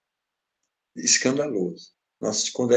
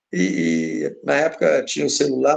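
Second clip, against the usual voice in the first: normal talking voice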